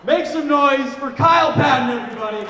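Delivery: high-pitched stage introduction; announcer introduction. Real, not acted. Host welcoming the headliner at a Christmas party, announcer call.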